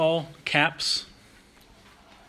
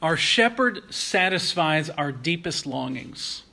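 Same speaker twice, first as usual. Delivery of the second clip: slow, weighty, every word placed; slow emphatic statement. Acted. Preacher announcing his first point, slow and deliberate. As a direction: lay down the main point slowly.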